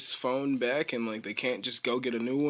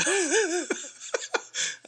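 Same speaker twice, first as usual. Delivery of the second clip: real squeaky laugh; high laugh. Real, not acted. Amused by the kid talking so eagerly, high laugh. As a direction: burst into a high giggle.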